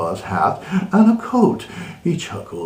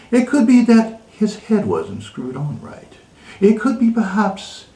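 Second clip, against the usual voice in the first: higher, playful guessing; 8 semitones higher than usual. A narrator's reading. Reader teasing possible reasons to the audience. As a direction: toss the guesses up lightly.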